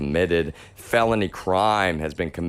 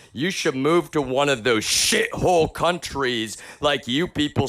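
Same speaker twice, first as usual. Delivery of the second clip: furious rant; 8 semitones higher than usual. Real, not acted. Ranting at his critics.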